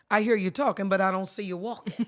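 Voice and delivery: loud, folksy granny admonishment; granny's saying. Retold or imitated. Quoting late granny's saying to the host, loud granny voice. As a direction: call it out like a granny who is not fooled. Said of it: "if my late granny was alive, she'd say".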